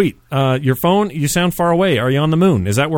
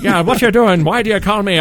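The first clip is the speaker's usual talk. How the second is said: angry Irish mother; 7 semitones higher than usual. Retold or imitated. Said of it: "an angry Irish mother on the phone".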